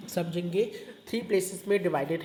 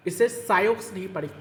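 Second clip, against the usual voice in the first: usual speaking voice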